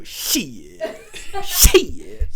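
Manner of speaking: drawn-out "shee-it" with a swoop; imitated country catchphrase. When someone does something stupid, drawn-out Southern catchphrase. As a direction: stretch it into a long Southern "shee-it". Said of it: "we go".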